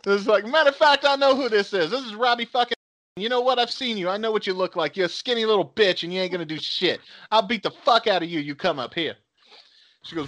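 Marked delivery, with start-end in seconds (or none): 0.5-9.2 higher-pitched mock tough talk; retold angry threat. Retelling a woman's threats over the phone, mock angry. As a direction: act out the caller's threats.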